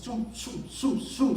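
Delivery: quick, soft "zum" bursts; airy "zum" sound effects; imitated whooshing sounds. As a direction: make little hissing pops, one after another. Imitates air bubbles popping off him.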